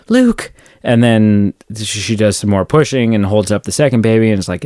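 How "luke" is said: tender Padmé. Retold or imitated. Dying mother naming her newborn son, much higher tender voice. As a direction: breathe the name out lovingly, higher. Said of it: "And she's like".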